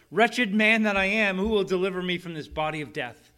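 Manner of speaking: loud, high anguished outcry; anguished cry. Retold or imitated. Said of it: "He concludes with this cry".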